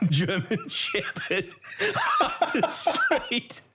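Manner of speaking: words squeezed out through laughter; breaking up laughing mid-phrase. Real, not acted. Laughing through funny story.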